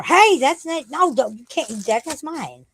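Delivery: high, sudden telling-off; urgent high-pitched "no, don't"; real pet scolding. Real, not acted. Scolding a pet after food.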